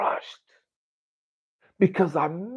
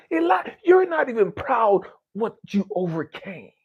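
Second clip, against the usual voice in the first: impassioned rebuke; 8 semitones higher than usual. Retold or imitated. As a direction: shake him awake with raw urgency. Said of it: "Conversation me and God had".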